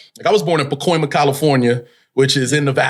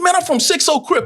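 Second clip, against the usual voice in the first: defiant claim; 12 semitones higher than usual. Retold or imitated. Replaying his in-character gang claim.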